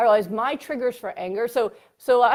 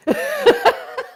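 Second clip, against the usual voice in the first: loud high laugh; 7 dB louder than usual. Real, not acted. Telling her friend about the salad post, a loud high laugh.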